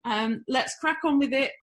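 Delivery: breeze in as if nothing could be wrong; breezy and blasé. Retold or imitated. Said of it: "but very blasé".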